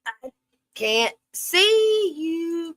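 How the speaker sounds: very slow, high drawn-out whine. Real, not acted. Streamer in the dark whining to her chat, slow and high. Complaining she can't see.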